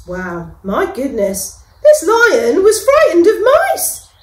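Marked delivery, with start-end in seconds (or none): 1.8-4.1 high, loud, laughing delivery. A narrator's reading. Narrator amused that the lion fears mice, laughing. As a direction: laugh at the irony.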